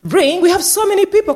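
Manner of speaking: high-pitched mock scolding; telling off. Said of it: "Someone would tell her off".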